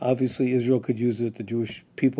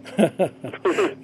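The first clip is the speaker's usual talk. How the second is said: short laugh; 5 semitones higher than usual. Real, not acted. Host laughs at remark.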